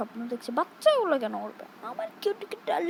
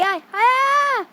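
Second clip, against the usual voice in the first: calling out; 8 semitones higher, 8 dB louder than usual. Acted. Calling the baby to come.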